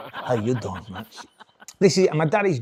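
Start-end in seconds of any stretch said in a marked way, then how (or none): 0.1-1.1 put-on low, suave Spanish-accented greeting. Spanish father charming son's girlfriend on the phone, Spanish accent. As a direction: sweet-talk her in a Spanish accent. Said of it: "Girlfriends used to ring up".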